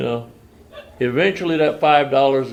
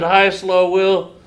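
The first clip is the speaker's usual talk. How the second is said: drawn-out, higher sing-song chorus hello; group greeting; 8 semitones higher, 6 dB louder, much slower than usual. Retold or imitated. The meeting greets the newcomer in a slow sing-song chorus. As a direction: stretch it out like a whole room answering.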